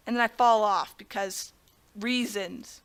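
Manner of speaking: ordinary talk, nothing marked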